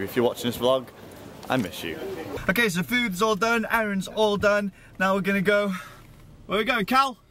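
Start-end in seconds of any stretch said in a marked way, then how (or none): 1.5-2.0 softer, lower, heartfelt; sincere. Real, not acted. Repeated softer to friend. 2.5-7.2 higher, upbeat and eager; excited. Real, not acted. Hyped up for go-karting.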